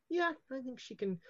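quiet, appraising murmur to herself; Madam Lin quietly appraising the new guest. Acted. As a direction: size the guest up approvingly under her breath. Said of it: "an appraising manner".

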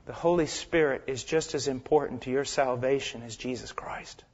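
hushed, intimate whisper; preacher whispering a solemn truth to the congregation. Acted. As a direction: whisper it like a secret.

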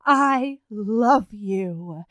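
a slow, drawn-out, lovesick "I love you"; mock tender confession. Over the intercom the wife confesses to Joe, slow and lovesick. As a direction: stretch every word like a swooning wife. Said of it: "she's like".

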